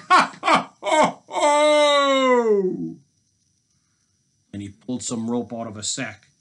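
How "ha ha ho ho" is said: very high, loud hammed-up laughter; big theatrical laugh, stretched at the end; roaring villain laugh. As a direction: roar with mock-villain glee. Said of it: "The robber roared with laughter".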